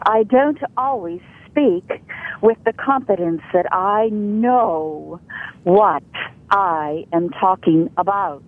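normal talking voice

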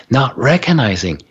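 normal talking voice

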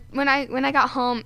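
normal talking voice

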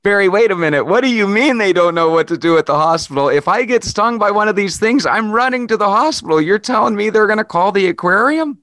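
can't believe what he just heard; incredulous outburst. Real, not acted. Host stunned by hospital remark.

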